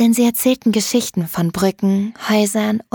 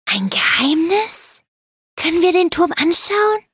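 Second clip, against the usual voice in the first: aufgeregtes Kind; 9 semitones higher than usual. A narrator's reading. Kind erfährt vom Geheimnis.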